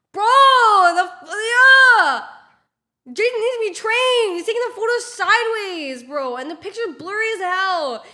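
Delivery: high, loud outburst of frustration; exasperated yelling. Real, not acted. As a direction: yell your complaint, starting at a scream. Exasperated over the bad photo.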